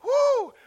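high woo; short joyful cry; joyful whoop. Real, not acted. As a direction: whoop for joy. Preacher whoops.

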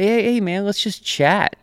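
high, falsely chummy boss voice; butter him up like a boss with bad news; mock fake-friendly boss. Boss luring employee into office, high chummy voice.

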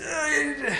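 high, held "uh" of awkward feeling; high choked-up noise. Shown as a demonstration. Man showing how it felt beside his dad, high strained noise. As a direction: let out a high strained sound. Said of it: "it's like this".

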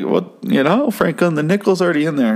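wife coaxing Franklin to pull the lever; timid wife. Retold or imitated. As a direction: nudge him gently in a small high voice. Wife pleading.

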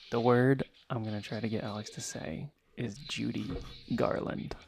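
hushed secret whisper to the mic; giggly prank whisper. Real, not acted. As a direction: whisper it so she can't hear. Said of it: "I'll whisper into the mic".

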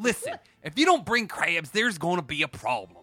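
exaggerated Baltimore twang, played for laughs; Baltimore accent. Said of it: "he was using the Baltimore accent".

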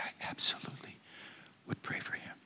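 hushed, breathy near-whisper, barely voiced. Retelling the woman's promise to pray, whispered.

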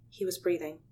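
ordinary delivery, nothing marked

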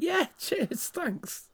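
high-pitched, laughing mock thanks; squeaky thanks. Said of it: "I was like".

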